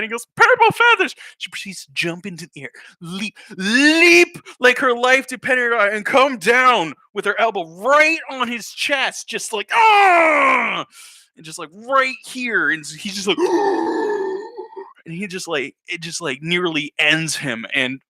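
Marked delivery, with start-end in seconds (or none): 0.4-1.1 yelled out high and loud; shouted word. Retold or imitated. Kid blurting the forbidden word, shouting. 9.7-10.8 high loud shout of attack; mock battle yell. Secretary slamming elbow onto kid, battle shout. 13.4-14.8 high winded gasp; mock gasp. Winded kid after elbow drop, gasping.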